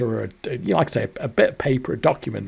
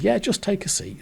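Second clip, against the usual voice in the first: bank clerk; 9 semitones higher than usual. Retold or imitated.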